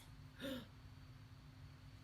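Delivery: sharp frightened intake of breath; breathy gasp. Acted. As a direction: gasp as if you see the gator. Gasp at the gator.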